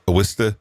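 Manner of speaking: Worcester said as wuss-tuh; Worcester the Boston way, wuss-tuh; local way. Shown as a demonstration. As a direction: clip Worcester to two syllables, wuss-tuh. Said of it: "not Worcester".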